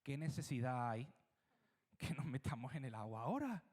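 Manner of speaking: hushed, coaxing advice to Jesus; lightly voiced persuading disciple; cheeky advice to Jesus. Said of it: "le daría un consejo atrevido".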